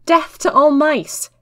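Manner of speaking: loud, slow, high battle cry; shouted. A narrator's reading. Maud shouting to distract Wrath, a slow loud cry. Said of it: "I shouted the first thing".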